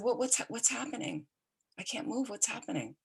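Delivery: mildly acted alarm; retold fearful confusion. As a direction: imitate bewildered fear, kept soft. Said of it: "Very fearful".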